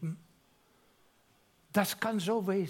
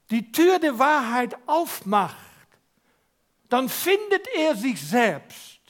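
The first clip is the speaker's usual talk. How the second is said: higher, louder pulpit voice; forceful preaching; 6 semitones higher, 6 dB louder than usual. Real, not acted. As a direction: proclaim it with growing force. (kraftvolle Predigt)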